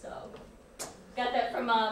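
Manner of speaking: ordinary delivery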